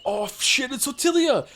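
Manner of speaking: high, loud surprised cry; shocked realisation. Real, not acted. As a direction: yelp as she recognises her. Sudden recognition of the witch.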